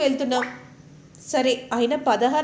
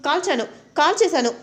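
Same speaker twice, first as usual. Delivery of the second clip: a louder, higher triumphant declaration; loud boast; 4 semitones higher, 6 dB louder than usual. Acted. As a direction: announce it proudly to the whole house. Husband boasting loudly at parents.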